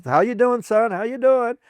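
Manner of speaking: bright, high, bouncy hello from a cheerful lady; bubbly greeting. Retold or imitated. Retelling Gracie greeting a wounded soldier, high and bubbly.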